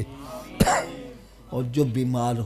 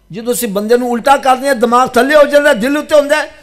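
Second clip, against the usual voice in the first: loud, high, driving sermon voice; forceful high-pitched explanation to a congregation; impassioned preaching; 12 semitones higher, 13 dB louder than usual. Real, not acted. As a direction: preach it out with raised pitch and volume. Preaching, raised delivery.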